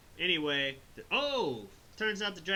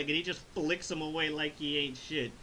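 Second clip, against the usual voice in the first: ordinary talk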